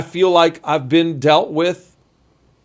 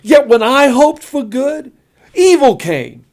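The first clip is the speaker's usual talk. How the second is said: lament reading; 8 semitones higher, 5 dB louder than usual. Acted. Reads Job's lament with feeling.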